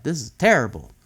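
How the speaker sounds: ordinary delivery, nothing marked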